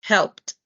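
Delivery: helped said as helpt, one syllable with final T; correct helped. Shown as a demonstration. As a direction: clip it to helpt. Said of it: "Es como si estuvieras diciendo esto".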